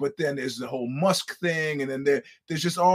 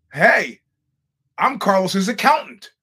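higher, louder stranger's proud intro; loud announcement; 7 semitones higher, 9 dB louder, slower than usual. Retold or imitated. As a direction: call it out to get attention.

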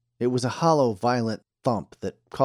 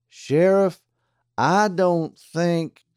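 deputy voice; 9 semitones higher, 7 dB louder, much slower than usual. A narrator's reading. Pete the deputy objects.

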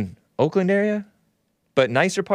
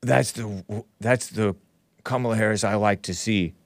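mock fawning quote; 7 semitones lower, 4 dB quieter than usual. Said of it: "And then he's all kissing up".

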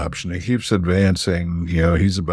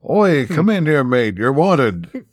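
a higher, louder retold call to the guard; mock yell; 6 semitones higher, 3 dB louder, slower than usual. Ben calling the Cyberman guard in, mock yelling. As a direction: shout him into the room, half in jest. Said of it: "he yells".

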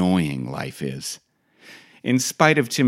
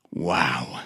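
usual speaking voice

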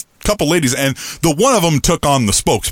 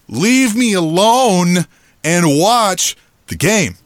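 slow, higher-pitched, emphatic demand; firm retold warning; 5 semitones higher, much slower than usual. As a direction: draw out each word as a warning. Retold angry warning to the women.

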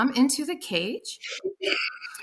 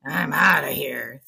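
gruff biker pig; 7 semitones lower than usual. Acted. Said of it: "biker with a pack-a-day habit".

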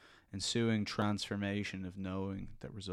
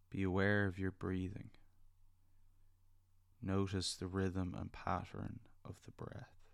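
soft spa whisper; 4 dB quieter than usual. Acted. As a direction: gently lead them into calm. Leads a guided meditation.

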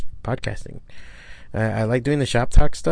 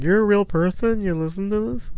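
much higher, incredulous questions; mock astonishment; amazed disbelief; 9 semitones higher than usual. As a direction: gape at a real live listener. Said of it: "I'm like".

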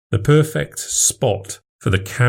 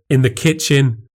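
higher, doubting question; skeptical wife; 6 semitones higher than usual. Acted. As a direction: raise an eyebrow at him. Wife's suspicious question.

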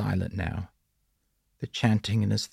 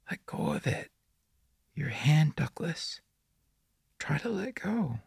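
put-on whispery, high child voice; prepubescent boy; 8 semitones higher, 5 dB quieter than usual. A narrator's reading. The boy in the woods praises Douglas, in an eerie childlike whisper. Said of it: "his phlegmy, prepubescent voice".

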